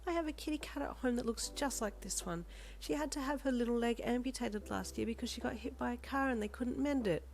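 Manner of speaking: high, tender, wistful telling; sad smile. A narrator's reading. Woman recalling her injured kitty, sadly smiling. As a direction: speak through a sad smile. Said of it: "she said with a sad smile".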